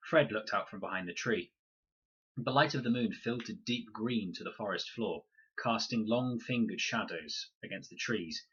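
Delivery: usual speaking voice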